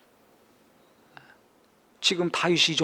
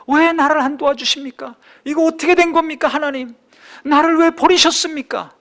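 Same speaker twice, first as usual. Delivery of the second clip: much higher, louder desperate plea; anguished cry; 10 semitones higher, 7 dB louder than usual. Acted. David crying out to God in despair, anguished shout. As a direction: cry out as if God has abandoned you.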